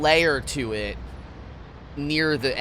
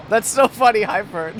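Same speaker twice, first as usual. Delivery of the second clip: ordinary talk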